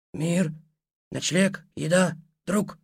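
high, slow eerie creature whisper; inhuman whisper. Acted. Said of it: "тихий, нечеловеческий голос прошептал".

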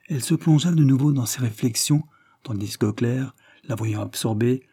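ordinary delivery

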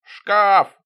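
high loud whine; whining. Acted. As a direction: whine like a put-upon porter. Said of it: "заныл Шоколадный". (нытьё)

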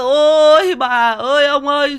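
gào khóc đám ma kiểu cường điệu, giọng cao vọt, rất to; high, loud, drawn-out mock funeral wail; giả khóc lóc. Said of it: "Thấy người ta khóc rồi". (giả vờ khóc than thảm thiết)

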